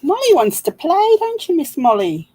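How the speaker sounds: squeaky high voice for the dog, loud and bright; high doggy talk. Real, not acted. Talking to her dog Molly, high-pitched baby talk.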